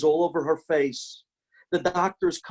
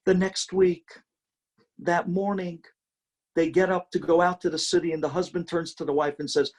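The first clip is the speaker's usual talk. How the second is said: normal talking voice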